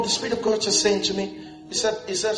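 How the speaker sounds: ordinary talk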